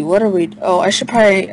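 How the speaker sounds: normal talking voice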